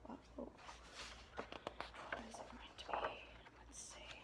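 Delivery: hushed whispered aside. Real, not acted. Reader whispers an aside to the child listening. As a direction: lean in and whisper it.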